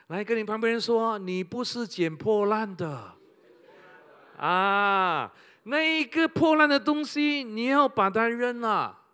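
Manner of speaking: loud, high urging from the pulpit; rally the congregation to throw it away; fervent pulpit exhortation; rousing preaching. Acted.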